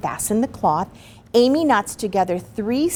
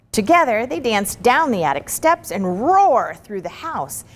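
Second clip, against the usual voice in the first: ordinary delivery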